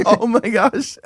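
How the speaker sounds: high laughing oh my gosh, slowed down; amused disbelief while laughing. Real, not acted. Laughs at buying a dictionary.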